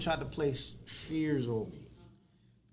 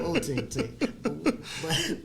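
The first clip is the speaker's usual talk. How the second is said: higher, very slow and laughing; drawn-out laughing call; 5 semitones higher, 4 dB louder, much slower than usual. Acted. Playful host calling out to his co-host, slow and laughing. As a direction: stretch it out through a laugh.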